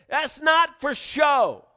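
high, slow preaching shout; emphatic warning. Acted. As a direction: belt it out, stretching each word. Preacher voices God's emphatic warning.

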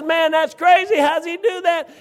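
high, loud disbelief; can't believe what's in the mirror; astonished. Retold or imitated. Rich driver stunned by the moped in his mirror, high loud disbelief. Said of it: "He says".

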